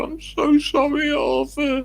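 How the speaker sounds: very high, pleading sorry; hammed-up high whining friend; whiny apology. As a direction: squeak out the apology like a caught thief. Friend apologises in retold quarrel.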